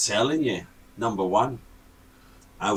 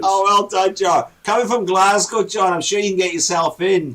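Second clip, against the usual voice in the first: loud, higher-pitched jeering; teasing shout; 6 semitones higher, 7 dB louder than usual. Real, not acted. Co-host teasing John locked out of the house, loud jeer. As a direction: call out mock praise across the room.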